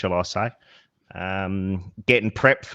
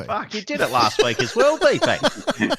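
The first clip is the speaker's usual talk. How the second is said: laughing; 11 semitones higher than usual. Real, not acted. Teasing mate, laughing.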